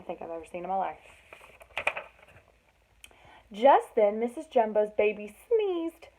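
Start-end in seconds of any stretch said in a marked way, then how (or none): none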